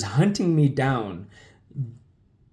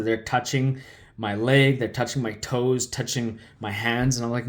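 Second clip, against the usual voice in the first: normal talking voice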